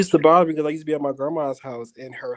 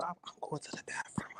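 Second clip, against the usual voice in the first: sheepish hushed whisper; 19 dB quieter than usual. Retold or imitated. Sneaking to the bathroom at night, caught, sheepish whisper. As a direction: whisper it like you're sneaking past. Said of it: "It's been like".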